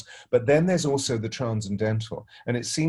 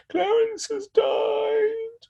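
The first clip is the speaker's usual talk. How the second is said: put-on very slow, much higher child's voice; grieving little boy; 3 dB louder, much slower than usual. Godson tells him the cat died, slow high sad child voice. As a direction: draw it out like a weeping child.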